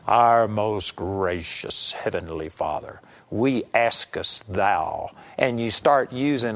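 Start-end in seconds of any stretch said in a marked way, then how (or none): 0.1-5.1 much lower, slow, solemn Elizabethan prayer tone; religious prayer voice. Retold or imitated. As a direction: put on a pious, archaic preacher voice. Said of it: "change your whole voice and character".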